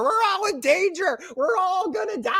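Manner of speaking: very high mock wail of doom; mocking Reggie's overacted warning, very high; hammed-up panic. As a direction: overact the doom like bad acting. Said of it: "Bad acting Reggie's ringing a bell".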